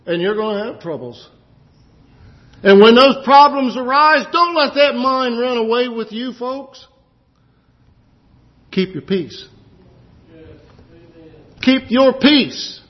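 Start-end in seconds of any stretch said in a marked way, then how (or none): 2.6-9.5 high, loud preacher's call; fervent preaching. Acted. Preacher urging the congregation not to fear, rising fervor. Preacher builds to full force. 11.6-12.8 slow, high and loud "Keep your peace"; emphatic repeat. Acted. Preacher repeating to the congregation, slow and emphatic. Repeated exhortation, second louder.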